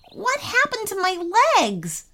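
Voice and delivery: high start dropping at the end; bewildered question. Acted. Boy sees his stretched legs, bewildered. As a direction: pitch it up in mild shock.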